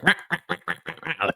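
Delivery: high, rapid animal yaps; high-pitched mock barking; imitated barking. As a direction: bark like a crazed little beast. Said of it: "barking, like".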